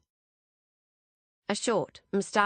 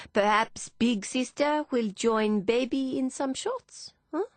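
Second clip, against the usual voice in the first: put-on slow, higher French-accented drawl; heavy French accent; 7 semitones higher than usual. A narrator's reading. Philippe smiling round his cigar, heavy French accent. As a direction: stall them, in a thick French accent. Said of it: "in a heavy French accent".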